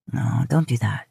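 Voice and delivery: low, gentle man's voice. A narrator's reading. Man stops his lover hiding her face, low voice.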